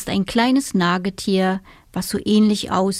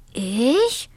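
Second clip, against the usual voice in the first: surprised; 4 semitones higher than usual. A narrator's reading. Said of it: "fragte der kleine Siebenschläfer erstaunt".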